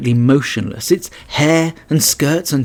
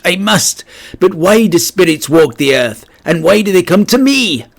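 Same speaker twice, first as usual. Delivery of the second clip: frightened pleading; 5 semitones higher, 4 dB louder than usual. A narrator's reading. Terrified Scrooge questions the ghost.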